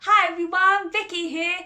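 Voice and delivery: bright, high and loud hello; excited greeting. Real, not acted. Host greeting young viewers, bright and excited. Cheery storytime greeting to children.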